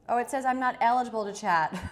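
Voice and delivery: loud, surprised exclamation. Real, not acted. Surprised at chat message.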